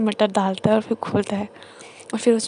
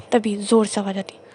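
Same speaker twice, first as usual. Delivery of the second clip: plain reading voice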